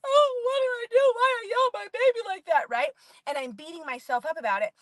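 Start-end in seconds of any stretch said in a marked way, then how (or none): 0.1-2.7 high, loud mock wailing; remorseful wail. Mom beating herself up after yelling, mock wail. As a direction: wail it high in hammed-up regret.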